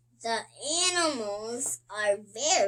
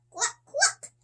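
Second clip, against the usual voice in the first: very high clucking; hungry chickens clucking, high and bright; 13 semitones higher, 3 dB louder than usual. A narrator's reading. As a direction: cluck like a hen. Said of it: "says the chickens".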